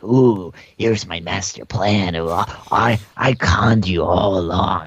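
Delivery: a hint of a movie villain revealing his plan; cartoon villain. Villain cornered at the movie's end, lightly mock gloating. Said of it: "the villain's like".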